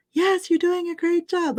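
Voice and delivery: high, singsong preschool teacher praise. Shown as a demonstration. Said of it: "I start to get into that preschool voice".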